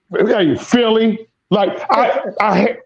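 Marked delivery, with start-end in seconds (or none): none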